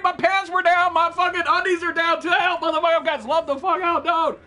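very high, loud frantic yelling; panicked scream. Real, not acted. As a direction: scream as if caught completely off guard. Knocked out while unprepared in game.

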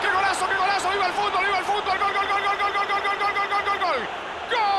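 high, sustained goal scream; breakneck goal commentary. Acted. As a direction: call the goal at full throat. Goal celebration call. (grito de gol eufórico)

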